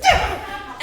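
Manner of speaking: high, laughing fake fury at a hanger; mock rage. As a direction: explode at the hanger, half laughing. Raging at the coat hanger.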